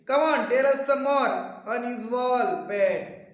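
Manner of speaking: loud, high, slow-paced prodding; eager urging. Acted.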